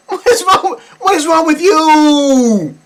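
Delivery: high, loud, laughing disbelief; outraged at the parents. Real, not acted. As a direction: yell it in disbelief.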